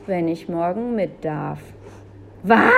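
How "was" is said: loud, sharp exclamation; loudly incredulous big sister; outraged disbelief. A narrator's reading. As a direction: burst out in indignant shock. Julia shocked at brother's condition.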